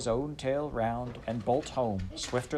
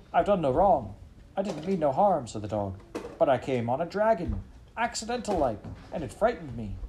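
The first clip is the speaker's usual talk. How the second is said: higher, louder whining protest; a frightened dog defending himself; pleading dog; 6 semitones higher, 3 dB louder than usual. Acted. Dog to his master, whining and pleading.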